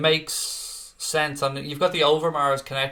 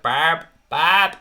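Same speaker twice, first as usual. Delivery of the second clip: loud, higher, badly faked Welsh accent; botched comic accent; 4 semitones higher, 8 dB louder than usual. Imagining old Craig passed out after Sunday dinner, botched Welsh accent. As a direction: call out in an awful sheep-like mock Welsh accent. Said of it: "full on royal family style".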